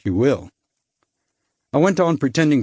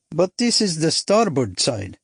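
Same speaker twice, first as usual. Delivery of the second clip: put-on Eastern European accent; 5 semitones higher than usual. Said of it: "definite flavor of Eastern Europe in his voice".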